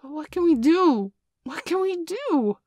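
high, loud helpless wail; a frightened child's pleading, slowing at the end; lost boys cry out at the wolves; panicked boys. A narrator's reading.

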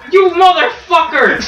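high, loud mock insult; playful angry yell. Real, not acted. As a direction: fire back with a joking curse. Arguing over a teammate kill.

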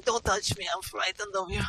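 mock frightened squeal. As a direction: squeak it like a fake frightened victim.

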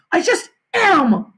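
high, loud, drawn-out retort; angry shout. A narrator's reading. Mother cutting off her son's protest, exasperated shout. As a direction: shout it to end the argument. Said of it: "my mother shouted".

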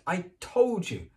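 slow, high breathless repeat; breathless, drawn out; gasping, slow. Acted. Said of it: "Hermione gasped".

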